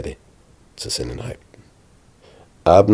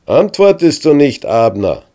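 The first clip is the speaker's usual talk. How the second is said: higher, louder taunting call; shouting; 9 semitones higher, 7 dB louder than usual. A narrator's reading. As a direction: shout the challenge across the hills. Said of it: "brüllt David so laut er kann".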